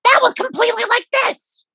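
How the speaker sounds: very high squeaky rat lady. Shown as a demonstration. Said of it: "my rat voice is very, very different".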